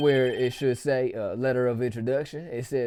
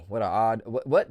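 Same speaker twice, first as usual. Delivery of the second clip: ordinary talk, nothing marked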